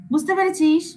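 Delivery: ordinary talk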